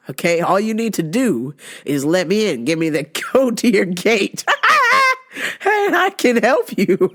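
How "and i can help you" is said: high laughing, slow; high, slow laughing come-on. Real, not acted. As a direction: giggle through the offer. Laughing offer to Demi.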